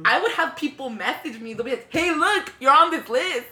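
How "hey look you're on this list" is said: excited. Retold or imitated. Friends telling her the news.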